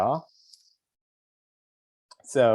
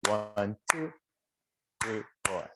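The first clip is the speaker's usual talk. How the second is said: one two, pause, three four; clap rhythm count; 6 dB quieter, slower than usual. Shown as a demonstration. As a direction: count it out in a clapped rhythm with a break. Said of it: "So ready, listen".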